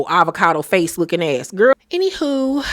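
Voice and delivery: ordinary talk, nothing marked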